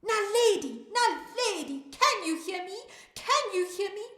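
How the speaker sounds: high, loud call to someone; shout. Acted. Storyteller rehearses calling to Naledi with listeners, shouting. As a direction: call out loudly to be heard. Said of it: "We're going to shout".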